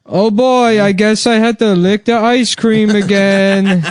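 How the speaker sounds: high, slow dopey voice; hammed-up dopey inmate; mock simpleton. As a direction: play a dim prisoner. Voicing himself playing dumb.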